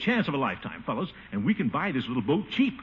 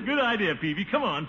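ordinary talk

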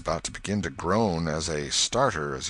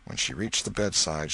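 plain reading voice

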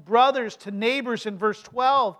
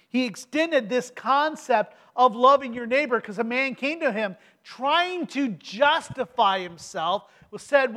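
usual speaking voice

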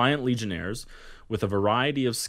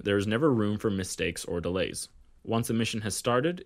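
ordinary talk, nothing marked

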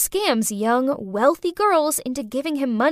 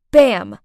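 loud bam; 3 semitones lower, 10 dB louder than usual. A narrator's reading. Sudden crash in story.

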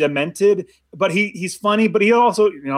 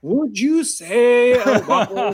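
psychiatrist; 4 semitones higher than usual. Retold or imitated. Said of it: "it was almost like his psychiatrist".

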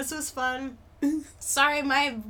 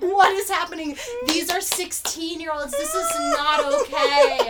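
mock outrage; 8 semitones higher, 8 dB louder than usual. Viewer appalled by teen reality show, rising mock outrage. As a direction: build to an appalled shout.

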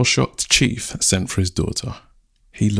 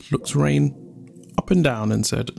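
ordinary delivery

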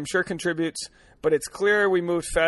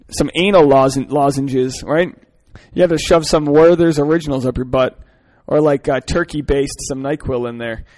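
much louder, half laughing through it; louder giddy riffing; 9 dB louder than usual. Real, not acted. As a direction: crack yourself up and push it out loud. Laughing riff on the letter.

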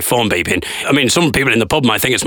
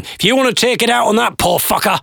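high, mock-threatening heckler; imitated heckler; 9 semitones higher than usual. Heckler defends Farage to comedian, high mock challenge. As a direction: challenge him in a raised mock voice. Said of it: "now I'm being told it's terrible".

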